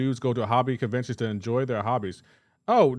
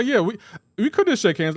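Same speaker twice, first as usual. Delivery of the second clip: mock cheerful; 8 semitones higher, 6 dB louder than usual. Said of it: "Everybody say".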